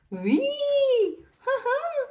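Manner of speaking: gleeful playground laughter; high giggling child. Acted. Children squealing with delight on the roundabout.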